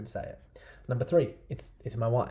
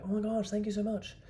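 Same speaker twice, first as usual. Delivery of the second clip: grateful woman; 11 semitones higher than usual. Retold or imitated. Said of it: "And she'll go".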